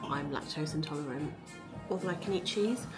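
normal talking voice

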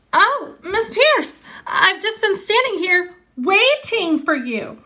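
high, slow, sugary call to the teacher. Acted. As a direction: sweetly stall her for time. Leslie loudly stalls the teacher.

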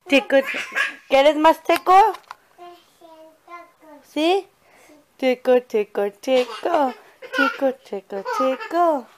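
high, loud giggly singsong; giggly tickle attack. Real, not acted. Playful tickling game with toddler.

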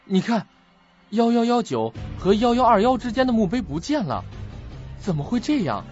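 acted alarm, voice rising; alarmed discovery. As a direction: blurt out the shocking find. Discovers the gravestone is missing.

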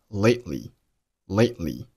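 "lately" with the t held back; unreleased t in "lately". Shown as a demonstration. Teacher demonstrating "lately" with an unexploded t. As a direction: stop on the t of "lately", don't pop it. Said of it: "爆拼t失去爆破".

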